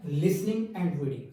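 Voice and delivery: slow, careful naming of key terms; spaced out for students to note down; slow and deliberate. Shown as a demonstration. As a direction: spell out each word slowly, like dictation. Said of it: "teacher stresses key terms".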